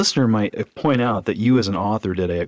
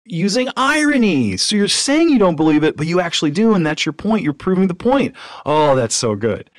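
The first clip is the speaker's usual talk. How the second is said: high, bright mock enthusiasm, much higher and louder; feigned delight; 9 semitones higher, 5 dB louder than usual. Theologian twisting the questioner's words, in mock delighted praise. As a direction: gush as if she has finally made your point. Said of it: "He's like".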